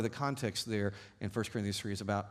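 ordinary talk

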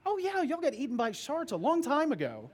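put-on high childish answer with a laugh; little girl. Daughter answering her father, high, childlike and laughing. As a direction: toss it off like a cheerful toddler.

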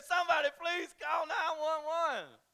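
high, loud, slow panicked cry; mock terror. Pastor hams up panic at the scary image, high loud cry. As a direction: beg for help in hammed-up panic.

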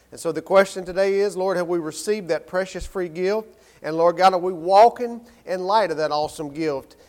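low, softened praying voice; opening prayer. Acted. Pastor asks God to open hearts before the sermon, quiet prayer. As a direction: pray quietly and earnestly to God.

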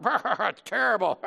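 loud, drawn-out dad-joke punchline. Acted. Dad repeating his restaurant joke, hammed-up. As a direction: pitch it up and stretch the word. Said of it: "like a dad joke".

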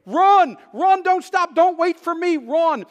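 loud urgent yell of run, run, don't stop; desperate shouted plea to a friend to keep running; retold shouting. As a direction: bellow it like you're fleeing in the dark. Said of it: "And I kept saying".

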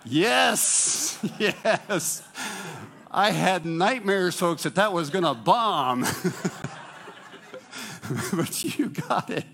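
loud, high, laughing celebration; real excited relief. Real, not acted. Relieved crowd got the answer.

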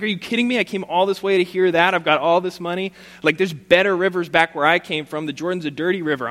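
mock outrage of a proud general; proud general huffing at a cheap cure; indignant outburst. Naaman indignant at the instruction.

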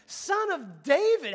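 drawn-out, high, loud cry; loud call over a crowd; yelling. Retold or imitated. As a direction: yell it slowly, pleading to be heard. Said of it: "he keeps yelling".

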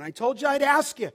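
loud, high retold challenge; emphatic acted confrontation; confronting. Pastor confronts man in retold scene.

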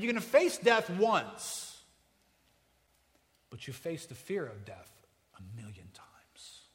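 ordinary delivery

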